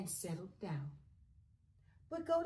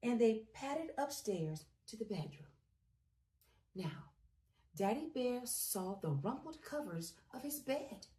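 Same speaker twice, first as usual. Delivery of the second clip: plain reading voice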